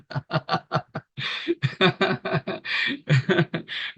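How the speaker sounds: sustained, soft laughter; long chuckle. Real, not acted. Preacher delighted by the verse he quoted, laughing. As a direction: let a long chuckle roll on after the verse.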